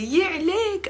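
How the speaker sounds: put-on "يا عليك" high and fond, like to a cute kid; doting exclamation. Shown as a demonstration. Teacher shows how she coos at a cute kid, high and doting. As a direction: coo "يا عليك" at a funny child. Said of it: "a kid does something cute or funny".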